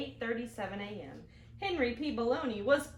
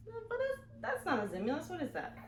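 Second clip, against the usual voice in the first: puzzled teacher; 7 semitones higher, 3 dB quieter than usual. Acted. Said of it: "Missus Bug Scuffle".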